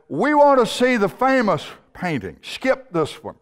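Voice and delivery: high-pitched shout from the back, dropping at the end; impatient heckler. Acted. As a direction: call out impatiently over the room. Heckler at back of auction room.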